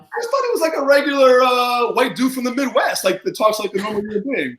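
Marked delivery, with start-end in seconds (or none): 0.0-3.0 high, laughing telling; laughing disbelief. Real, not acted. Telling the hosts about Gavin's accent, laughing and high. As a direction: giggle through the memory. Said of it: "that accent came out of nowhere".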